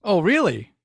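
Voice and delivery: ordinary delivery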